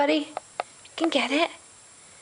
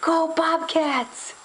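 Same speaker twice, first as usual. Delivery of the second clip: slow, raised cheer; slow cheer for baby; 5 semitones higher, much slower than usual. Shown as a demonstration. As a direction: drag the words for the baby. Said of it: "You're gonna say".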